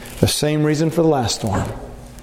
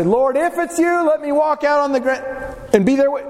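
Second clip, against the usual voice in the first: mock eager asker; 8 semitones higher than usual. Imagined naive request to Lord.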